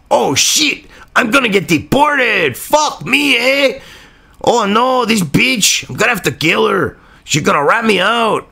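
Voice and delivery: sarcastic put-on Mexican accent, badly done. Vlogger mocking the killer's thoughts in a bad Mexican accent. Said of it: "excuse my bad, you know, awful Mexican sarcastic accent there".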